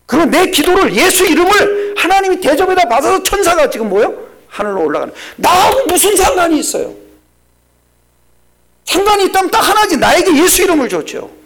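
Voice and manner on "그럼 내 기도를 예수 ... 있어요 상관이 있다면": loud, high fervent preaching. Acted. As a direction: preach it out to the congregation. Preaching at full force. (힘찬 설교조)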